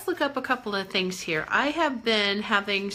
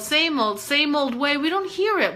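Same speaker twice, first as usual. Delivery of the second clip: ordinary talk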